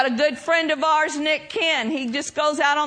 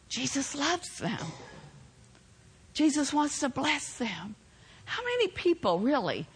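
lower, softer preaching, drawing them in; soft intimate preaching; 5 semitones lower, 7 dB quieter than usual. Real, not acted. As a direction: hush it down and plead gently.

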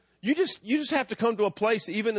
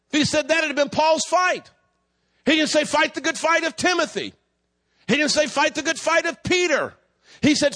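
forceful, high-pitched sermon delivery; raised, declaring preacher's voice; preaching; 4 semitones higher, 3 dB louder than usual. Real, not acted. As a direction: preach it out with emphatic phrasing to the congregation. Preaching after telling a story.

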